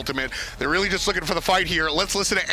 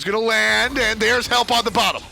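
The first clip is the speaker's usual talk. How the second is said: higher, louder climax call; excited play-by-play; 5 semitones higher, 5 dB louder than usual. Real, not acted. Caster calling a landing into a fight, excited. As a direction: build to the landing.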